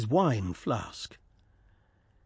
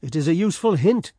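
a touch fierce, a little raised; 4 semitones higher, 5 dB louder, faster than usual. Acted. Ulrich fiercely answers Georg's threat, mildly fierce. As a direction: throw the hint back with mild fierceness. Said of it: "said Ulrich fiercely".

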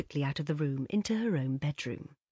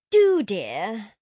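mocking coo; 4 semitones higher, 10 dB louder than usual. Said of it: "cooed Josie".

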